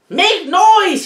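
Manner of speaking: high, calling shout; rally the creatures to make noise. Acted. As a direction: parrot shouting orders to the creatures. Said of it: "he shouted".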